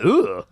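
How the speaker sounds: high disgusted groan; grossed-out ugh. Retold or imitated. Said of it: "That was my reaction".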